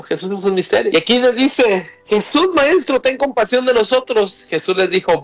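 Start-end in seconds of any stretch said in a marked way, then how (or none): 2.1-4.4 higher-pitched plea at talking volume; imitated crying out. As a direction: read it as a mild cry for mercy. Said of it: "y gritaban". (súplica en tono alto, grito imitado)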